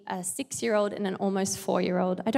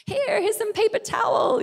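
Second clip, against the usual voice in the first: improvising mum; 12 semitones higher, 3 dB louder than usual. Retold or imitated. Mum calls back, improvising a fix.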